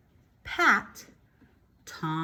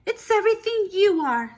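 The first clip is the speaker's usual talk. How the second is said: tender mother; 10 semitones higher, 6 dB louder than usual. Acted. Mother comforting.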